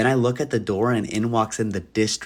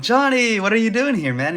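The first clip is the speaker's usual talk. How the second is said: much higher, startled question; surprised; 12 semitones higher than usual. Retold or imitated. Manager startled to see fired Johnny walk in, much higher. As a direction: caught off guard he came back.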